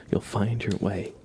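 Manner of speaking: ordinary talk, nothing marked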